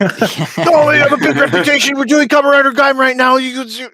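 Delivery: high squeaky boasting; mock whiny boast. Mocking Danny's boast about his show, high squeaky whine. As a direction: brag in a silly squeal.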